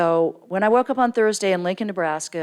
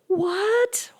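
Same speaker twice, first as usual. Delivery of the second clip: high startled yelp of surprise; shocked what. Retold or imitated. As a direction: blurt it out in total disbelief. Shocked reaction to pie news.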